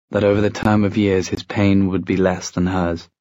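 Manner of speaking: usual speaking voice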